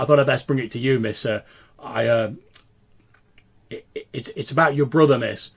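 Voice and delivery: deferential, halting voice with slight gruffness; slightly gruff servant. Acted. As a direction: deliver news hesitantly, a little gruffly. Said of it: "a slightly gruff voice says".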